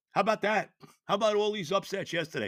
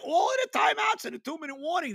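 1 exasperated shout; 8 semitones higher, faster than usual. Real, not acted. Fan ranting, repeating louder.

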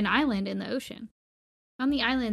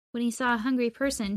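ordinary delivery

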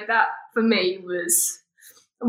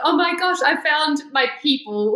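excited; 7 semitones higher than usual. Retold or imitated. Retold excited thought at uni.

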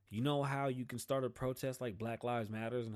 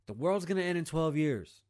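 loud mocking doomsday cry; hammed-up alarm, louder and higher; mock doom; 5 semitones higher, 7 dB louder than usual. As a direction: shout it like a panicked prophet.

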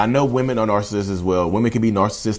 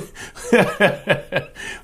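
breaking into a high giggle; high laugh; 7 semitones higher than usual. Real, not acted. Vlogger laughing at own mock advice, high giggle. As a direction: laugh at your own joke.

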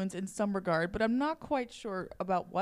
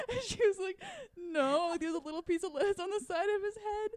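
laughing; 9 semitones higher than usual. Real, not acted. Telling funniest part laughing.

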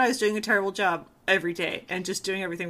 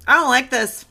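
blunt announcement; 3 semitones lower, 7 dB louder than usual. Pretend. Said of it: "You need to be like".

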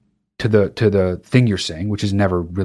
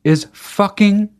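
ordinary talk

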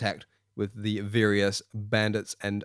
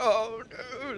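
scared cry; 12 semitones higher, 7 dB quieter than usual. Acted. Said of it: "squirming and grunting".

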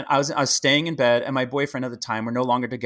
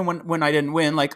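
usual speaking voice

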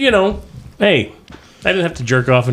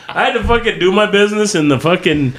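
ordinary talk, nothing marked

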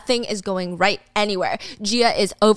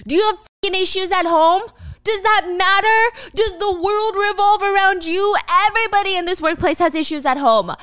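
higher, louder, fast boss tirade; yelling boss; 9 semitones higher, 3 dB louder than usual. Retold or imitated. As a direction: chew out the employee. Boss yells at Gia.

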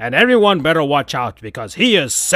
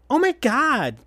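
much higher startled gasp; shocked little friend; alarmed Tails; 11 semitones higher than usual. Acted. Tails reacting to the breakup, high and alarmed.

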